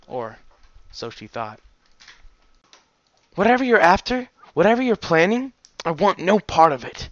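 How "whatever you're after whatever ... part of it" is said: high, loud, heated, coming down gradually; angry refusal. Acted. Daughter refusing any part in father's plans, heated.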